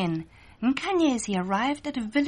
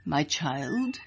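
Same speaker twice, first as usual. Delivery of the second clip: a lower chief's voice, a touch softer; kindly chief; 7 semitones lower, 3 dB quieter than usual. A narrator's reading. Chief calling Nkanyezi in the morning, lightly voiced and lower. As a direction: address the girl like a fond old leader.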